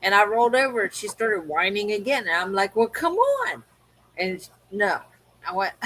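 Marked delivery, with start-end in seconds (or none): 2.8-3.6 retold exasperation. Owner retelling second plea to whining dog, high and exasperated. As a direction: pitch leaps high on a pleading "on".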